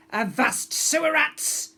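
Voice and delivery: high, loud slow pirate shout. A narrator's reading. Pirate girl shouting at the guests, loud and slow. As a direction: bellow it at the crowd. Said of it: "she shouted".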